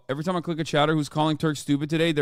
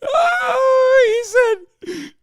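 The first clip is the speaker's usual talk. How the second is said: high laughing yelp, much louder; laughing shout; 6 dB louder, much slower than usual. Real, not acted. Streamer losing it at a chat message, laughing shout. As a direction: shriek it out mid-laugh.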